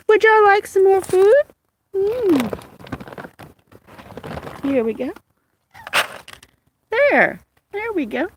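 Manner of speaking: high pet-talk offering food. Real, not acted. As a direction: coo to the cats as you feed them. Feeding her cats.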